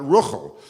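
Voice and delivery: Ruchel said RUKH-ul, stressed at the start; Yiddish pronunciation. Shown as a demonstration. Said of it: "the way it is in Yiddish".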